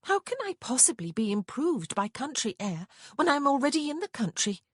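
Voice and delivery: high, scoffing incredulity; scornful snort. A narrator's reading. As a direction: scoff at the silly idea. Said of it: "Prudence snorted at that ridiculous notion".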